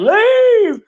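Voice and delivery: high, loud mock yell of leave; hollering woman impression. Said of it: "she was over there hollering".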